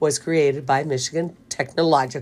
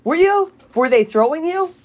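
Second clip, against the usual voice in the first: high, loud disbelief; surprised; 11 semitones higher, 4 dB louder than usual. Real, not acted. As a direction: sound genuinely startled. Surprised reaction.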